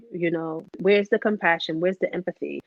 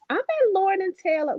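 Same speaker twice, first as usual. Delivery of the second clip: high, cheery mother chirping about shopping; breezy mother voice; 10 semitones higher than usual. Retold or imitated. Mother phoning her grieving daughter, breezy and high. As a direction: play the carefree mom, pitched up high. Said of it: "be like".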